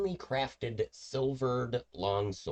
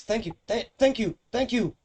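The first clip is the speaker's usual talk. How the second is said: high, louder and louder frantic thanks; a starving halfling gushing thanks as he bolts; grateful halfling; 10 dB louder than usual. Acted. Halfling thanking adventurers for food, high and building to a cry. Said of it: "he says".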